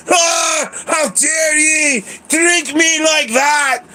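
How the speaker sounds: high, slow mock Scottish shout; Irish or Scottish milk. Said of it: "like, Irish or Scottish".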